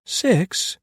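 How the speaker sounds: high, astonished echo; surprised. A narrator's reading. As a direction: squeak out disbelief. Clara astonished.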